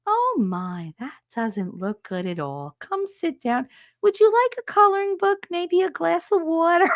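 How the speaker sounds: calm nurse voice. Retold or imitated. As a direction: offer the coloring book sweetly, unbothered. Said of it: "she was so calm".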